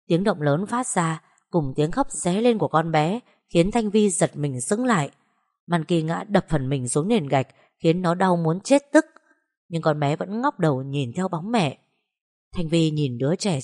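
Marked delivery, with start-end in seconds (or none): none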